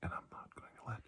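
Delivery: hushed, tender reassurance, partly whispered; reassuring. Acted. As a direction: soothe a frightened patient gently. Comforting the ill patient.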